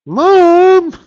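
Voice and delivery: loud, high yelp for Mom; calling for mom. Pretend. Kid stuck in the closet yells for his mom, loud high shriek. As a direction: shriek for help like a scared kid.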